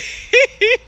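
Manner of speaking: high giggle; laugh. Real, not acted. Streamer teased about her accent, high giggle. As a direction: break up laughing.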